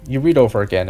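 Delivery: usual speaking voice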